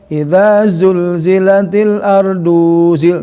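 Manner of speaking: "Idza zulzilatil ardu zil-" recited slowly, stopping after ardu; halting recitation. Shown as a demonstration. Teacher showing stopping at ardu, halting recitation. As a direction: break after ardu, hesitant. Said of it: "walaupun canggung".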